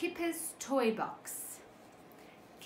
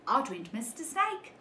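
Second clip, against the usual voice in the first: plain reading voice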